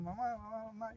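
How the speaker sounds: high, soft mock call; mock child's cry. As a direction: imitate a boy's cry, hushed and high. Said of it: "el niño sigue gritando". (grito fingido de niño, agudo y bajito)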